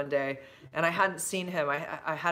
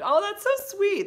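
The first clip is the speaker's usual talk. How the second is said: touched gush; 7 dB louder, slower than usual. Real, not acted. Reacting to viewer comment.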